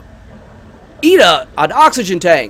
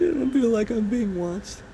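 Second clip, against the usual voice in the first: hushed creepy murmur; 6 semitones higher, 10 dB quieter than usual. Real, not acted. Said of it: "It feels like I'm being watched".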